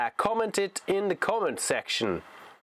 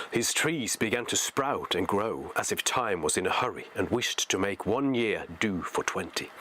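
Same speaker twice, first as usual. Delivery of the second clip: measured, lower-pitched storybook reading; story narration; 5 semitones lower than usual. A narrator's reading. Reading Tolkien aloud to listeners, story narration. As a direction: read it as an unhurried narrator.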